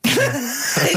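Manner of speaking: high-pitched laugh; burst of laughter. Real, not acted. Host amused by a spaghetti museum, high laughter.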